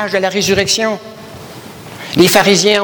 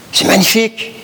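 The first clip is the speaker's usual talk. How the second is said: émerveillé; 6 semitones higher than usual. Real, not acted. Preacher exclaiming in wonder.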